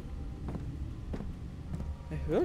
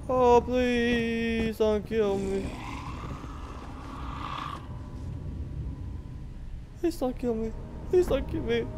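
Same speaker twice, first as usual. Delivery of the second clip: high pleading; frightened begging; 6 semitones higher, 5 dB quieter, slower than usual. Real, not acted. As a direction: beg for your life, voice up high. Begging a monster in game.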